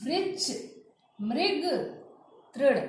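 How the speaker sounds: वृक्ष, मृग, तृण as "vriksh, mrig, trin"; no vowel before r in वृक्ष, मृग, तृण; blended ri. Shown as a demonstration. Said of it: "इसमें री मिला हुआ है".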